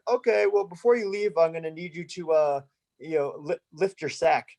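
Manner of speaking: mock-official request, hesitating before the awkward part; imitated creepy officer. Same officer's voice, retold order.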